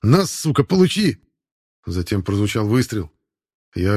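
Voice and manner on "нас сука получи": sudden angry yell, pitch jumping up; shout. A narrator's reading. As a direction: scream it out in rage. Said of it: "прозвучал чей-то крик".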